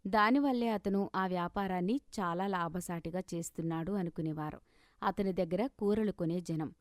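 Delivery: usual speaking voice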